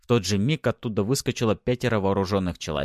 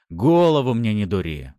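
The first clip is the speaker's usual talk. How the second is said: loud, tense warning; angry, through gritted teeth; 3 semitones higher, 7 dB louder than usual. A narrator's reading. Husband warning his wife, through gritted teeth. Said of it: "процедил мужик".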